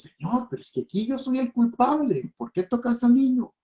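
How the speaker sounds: high-pitched pleading protest, retold; reproaching God. Father reproaching God over his sick child, high anguished protest. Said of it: "empecé a reclamarle a Dios".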